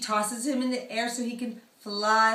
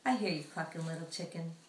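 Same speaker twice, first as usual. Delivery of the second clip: lower, soft goodnight; tender lullaby; 5 semitones lower, 9 dB quieter, faster than usual. Acted. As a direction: whisper him to sleep, gently. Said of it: "she says".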